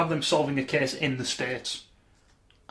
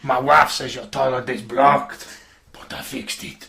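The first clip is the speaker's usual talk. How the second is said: creepy voice; 5 dB louder, slower than usual. Pretend. Creepy joke voice.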